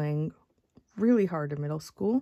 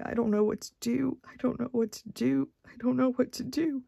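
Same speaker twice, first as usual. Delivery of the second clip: higher, pleading and helpless; distraught repeating; 7 semitones higher than usual. Retold or imitated. Mother rocking her son, repeating in despair. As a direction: repeat it like a mother coming apart. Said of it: "over and over again".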